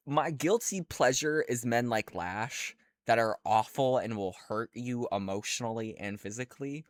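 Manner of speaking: ordinary talk, nothing marked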